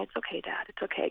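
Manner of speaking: soft, gentle retold reassurance; hushed comforting words to a parent; soothing. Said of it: "I'm soothing him and going".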